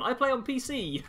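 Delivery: bright, high eager answer; excited. Real, not acted. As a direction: proudly blurt it out. Answers viewer question eagerly.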